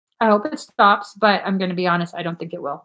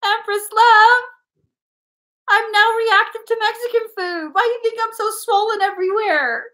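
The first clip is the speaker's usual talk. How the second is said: mock whine; 11 semitones higher, 4 dB louder than usual. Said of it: "He just went".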